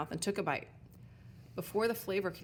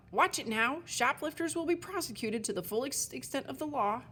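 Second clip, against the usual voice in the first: barked warning; 7 semitones higher than usual. Acted. Sign warns Elsbeth at the bakery, barked higher. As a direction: bark it at the customer. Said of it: "the sign barked out".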